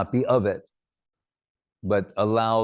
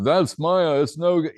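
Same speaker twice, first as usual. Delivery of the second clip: higher, louder sneering voice; a haughty purist scoffing; mock dismissive devotee; 6 semitones higher, 5 dB louder than usual. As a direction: play a preachy naysayer waving them off. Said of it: "You can't just go".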